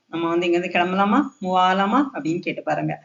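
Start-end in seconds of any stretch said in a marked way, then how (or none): none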